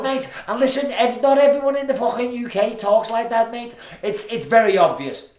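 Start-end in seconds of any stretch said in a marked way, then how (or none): 0.0-3.7 high, loud Scouse demonstration; exaggerated Scouse. Shown as a demonstration. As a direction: lay the Scouse on thick, incredulous. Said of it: "he fucking talks like this".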